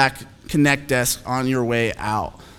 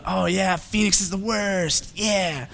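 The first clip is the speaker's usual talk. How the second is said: drawn-out, softer mock griping; mocking imitation; 3 semitones higher, 5 dB quieter, slower than usual. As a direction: imitate whining online commenters, stretching the words. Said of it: "I imagine that's how they speak".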